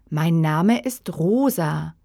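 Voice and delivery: usual speaking voice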